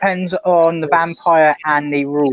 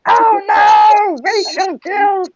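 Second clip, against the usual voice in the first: high-pitched mock scream of horror; 5 dB louder, much slower than usual. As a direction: scream it high, stretching out the words. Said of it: "it would be screaming".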